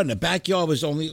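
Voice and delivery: ordinary delivery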